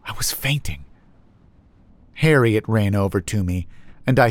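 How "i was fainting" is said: soft, faint whisper; fading whisper. A narrator's reading. Narrator collapsing on the grass, faint whisper. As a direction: let the voice fade as if fainting.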